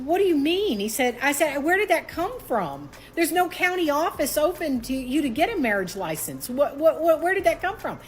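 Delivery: high, rattled disbelief; retold panic; frantic questions. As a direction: demand answers in alarm. Panicked at sudden marriage.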